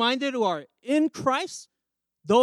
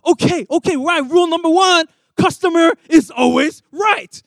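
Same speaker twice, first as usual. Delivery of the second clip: loud, higher, fawning customer-service voice; placating clerk; 4 semitones higher, 8 dB louder than usual. Retold or imitated. Hotel clerk appeasing a demanding guest, loud and eager. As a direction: soothe the customer eagerly, stretching the last words.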